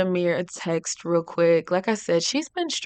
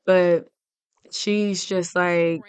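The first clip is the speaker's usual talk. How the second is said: ordinary talk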